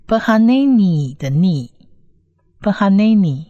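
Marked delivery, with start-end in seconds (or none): none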